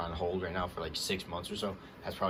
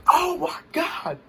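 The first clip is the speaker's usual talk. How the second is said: much higher, louder, slow disbelief; shocked shout; 9 dB louder, much slower than usual. Real, not acted. Fan reliving his reaction to the insult, shocked shout. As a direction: let it burst out, stunned.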